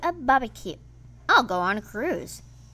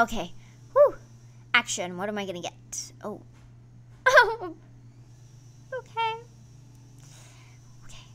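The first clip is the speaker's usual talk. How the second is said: normal talking voice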